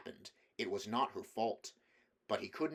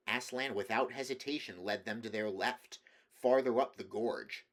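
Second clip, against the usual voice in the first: ordinary delivery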